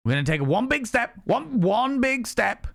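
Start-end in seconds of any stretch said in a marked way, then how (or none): 1.2-2.7 slow emphatic coaching. Retold or imitated. Coaching the big step.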